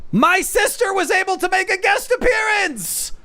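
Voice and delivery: high-pitched excited shout; hyped announcement. Real, not acted. Streamer announces his sister's guest appearance, hyped shout.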